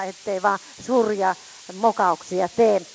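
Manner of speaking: ordinary delivery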